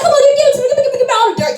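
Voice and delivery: high, loud, greedy for gossip; eager begging. Retold or imitated. To the friend, high eager begging for the dirt. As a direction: beg her to spill it.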